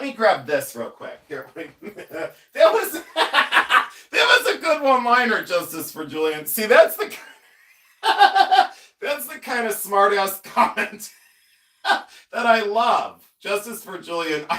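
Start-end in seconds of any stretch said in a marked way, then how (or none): 1.3-5.4 laughing. Real, not acted. Cracking up at viewer comment. 6.5-13.3 laughing. Real, not acted. Laughing about a smart-ass comment.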